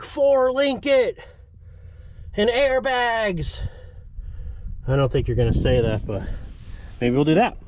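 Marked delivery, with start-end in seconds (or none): 0.0-3.7 eager viewer. Retold or imitated. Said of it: "if you guys are like".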